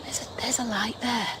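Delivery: tense whisper in the dark; scared hushed whisper. Real, not acted. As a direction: breathe it so nothing hears you. Alone in dark woods, ghost hunting.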